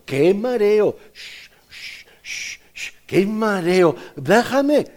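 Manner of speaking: high, whining complaint about the dizziness; dizzy teacup. Acted. Teacup spun on the wheel.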